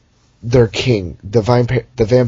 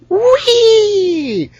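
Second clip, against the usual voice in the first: mock squeal; 3 dB louder than usual. Cliff jump squeal.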